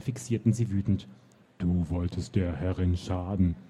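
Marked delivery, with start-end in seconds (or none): 1.6-3.6 low, slow booming giant; giant's call. Acted. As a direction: let it roll out like a huge giant. Giant voice held.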